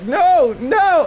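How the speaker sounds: high loud shout of "no"; frantic loud no; panicked yell. Retold or imitated. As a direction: scream in despair. Yelling in panic.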